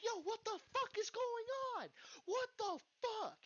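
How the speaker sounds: high, freaked-out cry; panicked alarm. Retold or imitated. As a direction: pitch it way up in alarm. Freaking out at friend's screaming.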